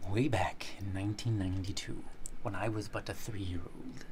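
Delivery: low, whispery once-upon-a-time voice; hushed storyteller. Pretend. Starting a childhood story for a friend, hushed storyteller. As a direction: open the tale in a hushed whisper.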